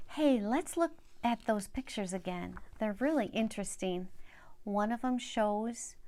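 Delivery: ordinary delivery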